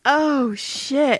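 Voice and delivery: usual speaking voice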